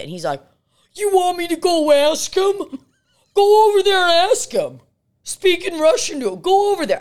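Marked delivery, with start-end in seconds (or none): 0.9-2.8 very high, loud mock voice; hammed-up high-pitched impression; imitated eager friend. Imitating Greg's eager offer. 3.3-7.0 very high, loud mock voice; hammed-up high-pitched nagging; imitated pushy friend. Imitating Greg's urging.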